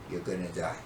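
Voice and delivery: low, soft deadly warning; quiet threat. Retold or imitated. Older brother silencing younger one, low hushed threat. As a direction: drop down low and quiet to menace. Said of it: "So I gave him the".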